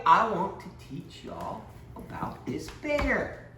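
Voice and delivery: usual speaking voice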